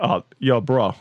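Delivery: lazy Boston bro greeting; Boston accent. Pretend. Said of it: "That's your Boston accent?".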